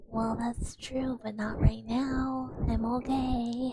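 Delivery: slow, stretched-out protest; drawn-out mock reassurance. Streamer answering people who misjudge them, slow mock reassurance. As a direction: draw each phrase out slowly.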